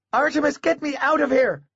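put-on high child's voice, ordering; bossy little boy. A narrator's reading. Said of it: "he ordered".

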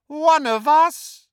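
high, loud, drawn-out jeer. A narrator's reading. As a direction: throw it back in scorn. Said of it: "jeered Voldemort".

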